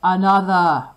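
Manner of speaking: loud roared demand, falling; roaring. Acted. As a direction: roar for another egg. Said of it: "roared the ogre".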